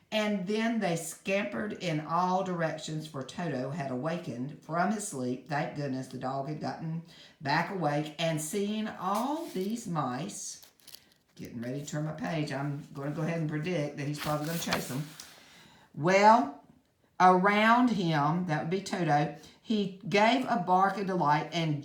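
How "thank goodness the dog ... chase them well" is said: plain reading voice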